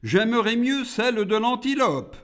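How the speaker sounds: high-pitched demon request; haggling demon. A narrator's reading. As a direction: pitch it up as the monster bargaining. Monster haggles with God.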